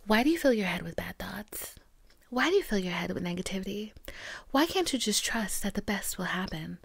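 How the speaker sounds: hushed whisper of the Bible's questions; whispered quote. Retold or imitated. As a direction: breathe it like a secret scolding. Voicing God's message from Psalm.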